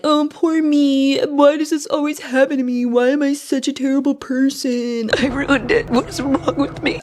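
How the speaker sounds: mock her sobbing self-pity, hammed-up; hammed-up whiny crying impression. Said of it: "is crying and being like".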